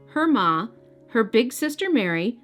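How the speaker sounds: usual speaking voice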